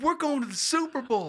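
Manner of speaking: very high, exultant fan cry; retold excitement. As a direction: re-enact a thrilled fan breaking the news. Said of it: "I got so excited".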